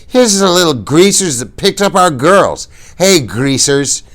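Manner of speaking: high, loud sneering bully; mock them from above, slowly; drunken taunt. A narrator's reading. Bob taunting the boys.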